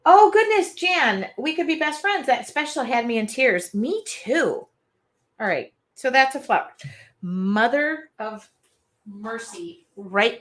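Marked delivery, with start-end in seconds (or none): 0.0-4.7 excited. Real, not acted. Reacting excitedly to chat.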